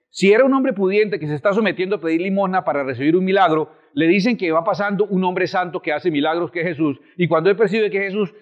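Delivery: ordinary talk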